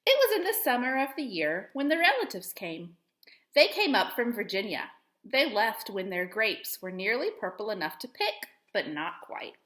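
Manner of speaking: gentle storybook reading voice; expressive picture-book read-aloud; reading to children. Acted. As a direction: read it like story time for young kids. Teacher reads picture book aloud.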